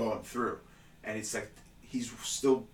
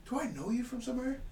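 much higher, sweetly innocent; high fake-innocent question; 13 semitones higher, 4 dB quieter, faster than usual. Asking the big guy, high mock innocence. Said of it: "I'm like".

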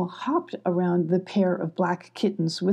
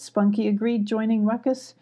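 usual speaking voice

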